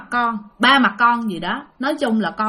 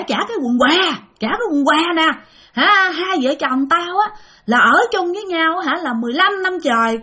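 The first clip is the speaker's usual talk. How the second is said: retold yelling; 7 semitones higher than usual. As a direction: shout back at the ghost wife, outraged. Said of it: "Tự nhiên la làng lên vậy".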